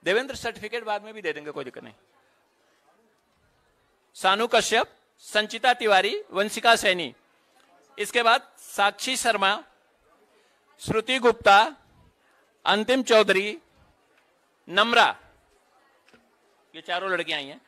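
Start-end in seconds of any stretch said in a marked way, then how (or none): 4.1-15.2 measured announcer voice, each name set apart; roll-call announcer. Real, not acted. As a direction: call out names at a ceremony.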